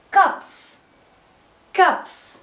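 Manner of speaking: "كابس" as cups, ending in a voiceless s; final s sound. Shown as a demonstration. Teacher showing s after p, "كابس" ending in s. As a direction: model "كابس" with a clear ps ending. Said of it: "سمعناها اس كابس عشان قبلها بي".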